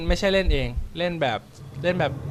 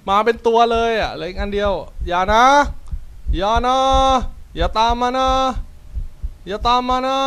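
loud, high frightened pleading; panicked shouting; 8 semitones higher, 5 dB louder than usual. Real, not acted. Fleeing the monster, begging it to stay back, panicked yell. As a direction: yell it in real panic while running.

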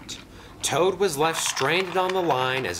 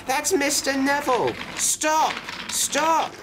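very high-pitched, urgent cry; alarmed cry. A narrator's reading. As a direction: cry out high, desperate to halt the train. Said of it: "he cried".

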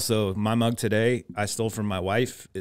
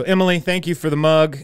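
excited; 7 semitones higher, 8 dB louder than usual. Real, not acted. Loud thanks to wife.

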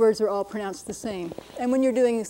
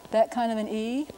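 ordinary delivery

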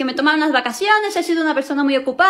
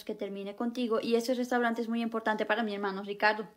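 quiet; 2 semitones lower, 7 dB quieter than usual. Acted. Sad breakup explanation.

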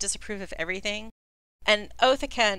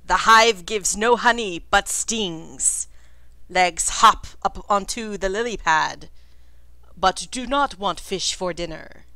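loud, portentous riddle-teller; loud in-character pronouncement; declaiming cryptic speaker; 6 dB louder than usual. Acted. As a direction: proclaim it like a cryptic oracle.

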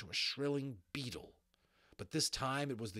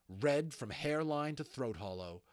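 ordinary delivery, nothing marked

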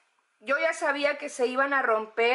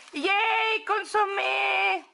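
high, delighted "Yay"; excited cheer; 6 semitones higher, much slower than usual. Real, not acted. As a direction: cheer for the soup. Cheering at soup packets.

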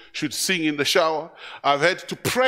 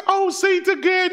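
much higher, delighted plea; thrilled parent; 13 semitones higher, 4 dB louder than usual. Retold or imitated. Preacher voicing a parent thrilled by baby's first word, much higher delighted plea. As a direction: beg the baby to say it once more.

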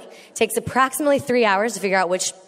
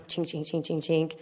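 quiet clanking coaster; 4 semitones lower, 9 dB quieter than usual. Retold or imitated. Coaster climbing the hill.

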